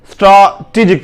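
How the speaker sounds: स्ट्रैटेजिक said "straa-tee-jik"; long open aa in the first syllable of स्ट्रैटेजिक; correct pronunciation. Shown as a demonstration. Teacher tells learners to read it this way, correct pronunciation. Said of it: "स्ट्रैटेजिक पढ़ेंगे इसे".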